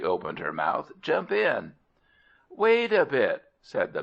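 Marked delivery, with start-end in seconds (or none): 1.0-1.7 high, pushy fish; eager fish voice. Acted. Fish urges man into mouth. 2.5-3.4 high, urgent plea to wait. Acted. Man begs fish to wait.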